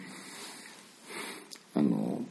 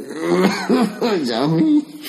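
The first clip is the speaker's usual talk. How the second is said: high drawn-out wail, laughing; slow wailing cry breaking into laughter; monster crying; 9 semitones higher, slower than usual. Retold or imitated. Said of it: "ジャミーって泣き方なの？". (笑いながら怪獣の泣き声)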